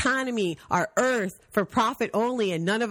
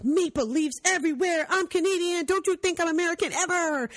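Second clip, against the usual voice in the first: high, mocking boastful Canadian voice; mock Canadian tourist; 11 semitones higher than usual. Mocking a Canadian tourist bragging about being Canadian, high. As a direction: ham up a smug Canadian abroad. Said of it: "it's like".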